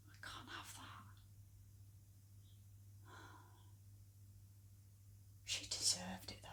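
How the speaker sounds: very soft, sinking almost to a whisper; barely voiced, with long pauses; hushed dread. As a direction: confide a dark secret under the breath. Fears her secret will be told.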